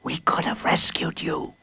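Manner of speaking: whispered at a cell window. A narrator's reading. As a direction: breathe it through the bars. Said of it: "said O'Toole".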